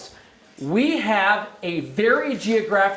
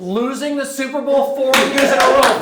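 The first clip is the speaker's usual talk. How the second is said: punchline; 3 semitones higher, 5 dB louder than usual. Real, not acted. Joke punchline about the Bills.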